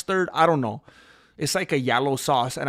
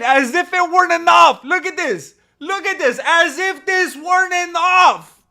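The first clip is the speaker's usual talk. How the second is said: excited disbelief; 13 semitones higher, 9 dB louder than usual. Real, not acted. More donations pile up.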